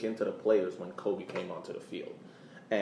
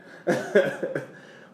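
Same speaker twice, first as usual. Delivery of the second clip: short amused laugh; real laugh; 7 semitones higher, 4 dB louder than usual. Real, not acted. Co-host laughing about the six-to-one demolition, amused laugh. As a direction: laugh it off mid-sentence. Laughing at lopsided All-Star loss.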